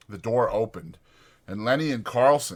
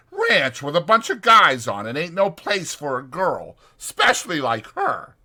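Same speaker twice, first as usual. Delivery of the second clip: higher, louder rough ranch hand; 9 semitones higher, 7 dB louder than usual. Acted. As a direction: speak it like a bunkhouse ranch hand. Voicing George on the ranch.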